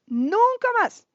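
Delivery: high, slow, loud declaration; emphatic vow. Retold or imitated. Woman vowing after her friend's death, emphatic and slow. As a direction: draw out never again with conviction.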